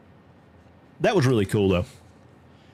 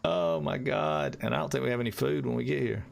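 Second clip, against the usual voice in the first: usual speaking voice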